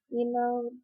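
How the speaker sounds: normal talking voice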